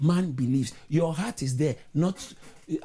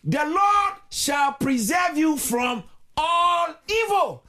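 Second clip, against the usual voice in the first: slow, high declaration with stretched words; full-force preaching; 13 semitones higher, 4 dB louder, much slower than usual. Acted. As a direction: proclaim it over the congregation. Declares blessing over congregation.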